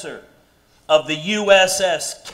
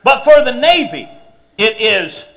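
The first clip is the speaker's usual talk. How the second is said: loud gung-ho soldier; 5 semitones higher, 5 dB louder than usual. Pretend. Captain drilling his sailors.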